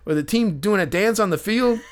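high, laughing mock ribbing voice. Retelling the ballplayer teasing him, high and laughing. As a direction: replay the roast high with a laugh. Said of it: "he's like".

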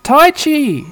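high, loud call of a name; Izzy calling out. Acted. Izzy calling to Tai, high and loud. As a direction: shout across the camp. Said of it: "is like".

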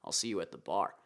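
quiet mock resignation; resigned grumble. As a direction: mutter it like a rider giving up. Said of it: "you're kinda like".

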